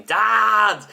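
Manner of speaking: put-on loud high child's call; child shouting Dad. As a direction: call out like an impatient kid.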